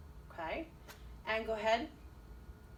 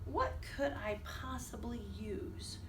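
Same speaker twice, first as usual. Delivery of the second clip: whisper; 7 dB quieter than usual. Acted.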